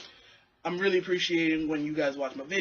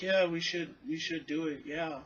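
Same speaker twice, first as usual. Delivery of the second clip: nervous agreement; 5 dB quieter than usual. Retold or imitated. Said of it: "me and my mom are like".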